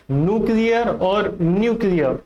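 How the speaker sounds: "न्यूक्लियर" first as NOO-klee-ar, then NYOO-klee-a; two rival pronunciations. Shown as a demonstration. Teacher repeats the choice for students, contrasting both. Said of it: "What could be the pronunciation".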